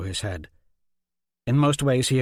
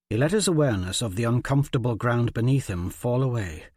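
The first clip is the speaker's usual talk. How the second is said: ordinary delivery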